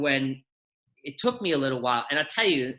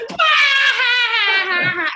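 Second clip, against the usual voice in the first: much higher, very loud yelp; kids' burst of laughter; 9 dB louder than usual. Retold or imitated. Children reacting to being told shut up, imitated loud yelp. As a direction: explode like delighted kids. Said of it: "they all just go".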